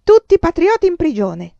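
loud, high rallying cry; defiant shout. Acted. Giada lucente rallying the crowd against police, loud shout. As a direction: fling it out for all to hear. La folla le fece eco.

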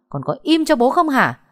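loud, high scolding father; angry shout. Acted. Father silences his struggling daughter.

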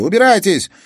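very loud, high, hurled out; furious shout. Acted. Sofia screams at the detectives to get lost, furious. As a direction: scream it at them in fury. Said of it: "яростно выкрикнула София".

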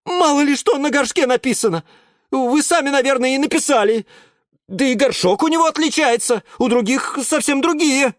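a nervous student insisting; young student Yura. Acted. Yura argues, still worked up. (Юра оправдывается, высоким голосом)

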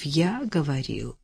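low, quiet, mildly pained correction; subdued, a touch displeased; slight wince. A narrator's reading. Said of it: "Чуть поморщился Лемешев".

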